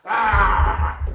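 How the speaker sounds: loud, high angry yelling; acted mad outburst. Showing viewers getting mad, shouting angrily. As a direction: yell out in a burst of temper. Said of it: "that's when I get so mad".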